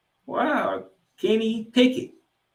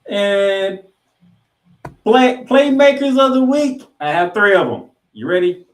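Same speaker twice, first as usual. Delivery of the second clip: loud, high hype-man build-up; hyped announcer; 10 semitones higher, 9 dB louder than usual. Real, not acted. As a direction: pump up the crowd for the big reveal. Hyping the playmakers segment.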